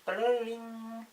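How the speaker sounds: high soft jingle word; sound effect. Pretend. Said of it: "I'll just say bling".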